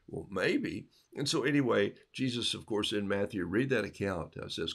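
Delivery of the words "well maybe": ordinary talk, nothing marked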